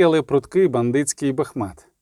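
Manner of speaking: plain reading voice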